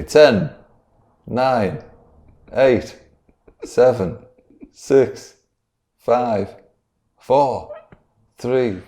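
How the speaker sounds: dramatic countdown. Acted. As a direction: count down to the big moment.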